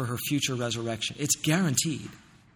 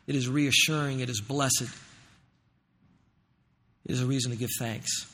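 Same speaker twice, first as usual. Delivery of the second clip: soft reassuring preaching; 5 semitones lower, 7 dB quieter than usual. Acted.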